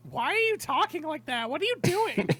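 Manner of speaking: high-pitched, baffled character voice; baffled protest. Acted. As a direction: squeal the complaint way up high. Daisy reacts to hepcat talk.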